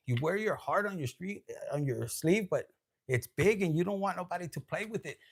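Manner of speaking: ordinary delivery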